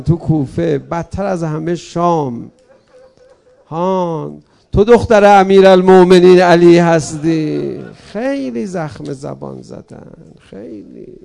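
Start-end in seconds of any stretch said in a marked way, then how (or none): 3.7-7.8 loud jeering shout; mocking taunt. 7.9-11.2 sorrowful fade. Acted. Grieving aside fading to nothing.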